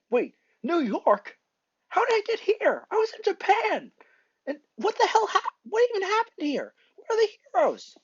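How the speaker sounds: very high, loud, panicked questions; panicked outburst. Acted. Boy learns he is in New York, high loud panic. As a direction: cry out in shocked disbelief.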